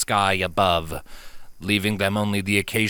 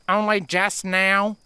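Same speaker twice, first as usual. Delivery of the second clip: deadpan; 11 semitones higher, slower than usual. Acted. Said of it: "her Earth Pony friend deadpanned".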